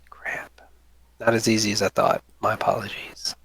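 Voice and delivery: muttered whisper while searching notes; hushed apology under the breath; whispering to self. Real, not acted. GM searching his notes, whispering. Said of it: "GM whispers while searching notes".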